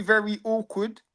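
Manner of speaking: usual speaking voice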